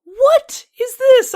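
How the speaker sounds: very high, loud, drawn-out outcry; retold disbelief. Customer finding his restaurant is a gas station, loud incredulous outcry.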